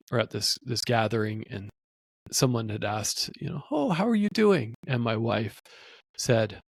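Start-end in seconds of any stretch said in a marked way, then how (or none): 3.7-4.7 much higher, sing-song greeting; lightly voiced small-talk greeter; bright greeting. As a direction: greet like a polite acquaintance, pitched up. Acquaintance asking at a gathering.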